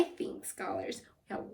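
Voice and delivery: whispered aside to the class. Real, not acted. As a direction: breathe it softly.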